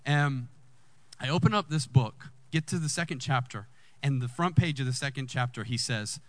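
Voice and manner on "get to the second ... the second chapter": usual speaking voice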